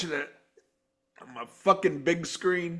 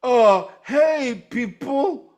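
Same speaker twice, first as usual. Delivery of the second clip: mock greeting; 5 semitones higher, 4 dB louder, much slower than usual. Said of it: "he's like".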